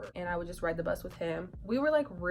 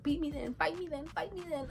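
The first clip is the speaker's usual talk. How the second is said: high mock challenge; 9 semitones higher than usual. Challenging friend to fight.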